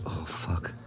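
whispered curse under the breath; hushed dismay. Retold or imitated. Musician retelling his mistake at the museum, whispered dismay. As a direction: drop to a whisper, as if caught out. Retold realization of public mistake.

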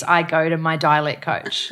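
usual speaking voice

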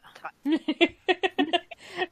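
loud, high burst of laughter; bright laugh. Real, not acted. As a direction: laugh out loud at your own joke. Laughs at own joke.

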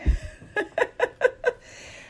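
a high, breathy burst of laughter; real high laugh. Real, not acted. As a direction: laugh out at the absurdity. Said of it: "And I'm laughing because".

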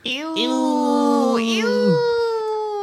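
high, drawn-out squeal of disgust; playful disgust. Pretend. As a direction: squeal it in mock revulsion. Reacting to the ick topic.

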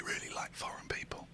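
hushed whisper. Real, not acted. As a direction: whisper it near the phone. Whispering while call rings.

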